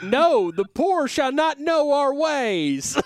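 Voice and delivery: high, slow, loud mock Southern grandee; Southern aristocrat. Host voices the trading app owners as snooty Southerners. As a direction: lord it over the poor in a Southern drawl. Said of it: "making these guys extremely Southern".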